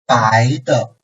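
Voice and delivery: 白的 rising, then short and light; second plus neutral tone. Shown as a demonstration. Teacher reads an example, 白的 with light neutral ending. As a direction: glide 白 upward, then touch 的 briefly without stress. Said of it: "this neutral tone syllable is after a second tone".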